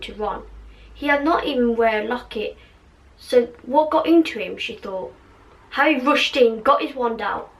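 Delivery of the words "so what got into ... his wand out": usual speaking voice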